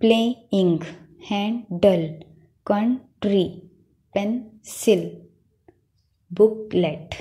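each word in two parts with a gap: "प्ले, इंग", "हैं, डल", "कं, ट्री", "पें, सिल", "बुक, लेट"; split into syllables. Shown as a demonstration. Teacher giving pupils two syllable examples, प्लेइंग to बुकलेट each split in two. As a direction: break प्लेइंग, कंट्री, पेंसिल, बुकलेट into separate syllables. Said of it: "two syllable words बनाने हैं".

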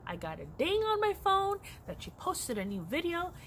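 lightly voiced eager son calling Mama; teen son telling mom about the video; son's voice. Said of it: "And he was like".

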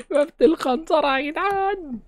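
mock announcer voice. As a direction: draw out the map name in a silly voice. Names the map, then repeats it. (صوت مستعار عالي وبطيء بتهريج)

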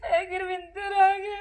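slow, high sobbing reply; crying. Acted. Mother telling the wife her son is dead, crying. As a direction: break down as you say it.

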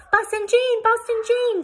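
put-on high, loud little-girl shout; excited child's yell. A narrator's reading. Sudie running up to Boston Jane, yelling excitedly. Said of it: "Sudie yelled".

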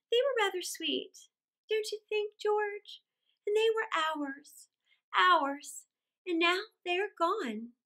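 high, tender mother's lament; wistful grief. A narrator's reading. Mother to husband about lost children, high and wistful.

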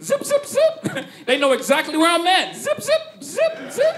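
very high squeaky zip zip; corduroy zip noise. Retold or imitated. Kid describing his corduroy shorts, very high squeaky zips. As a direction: squeak out the corduroy rubbing sound.

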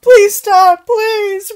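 mock pleading.

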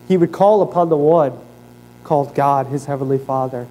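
usual speaking voice

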